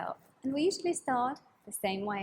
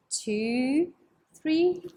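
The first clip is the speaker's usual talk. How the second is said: normal talking voice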